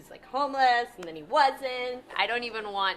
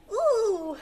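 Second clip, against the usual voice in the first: pleasure moan; 9 semitones higher, 5 dB louder than usual. Acted.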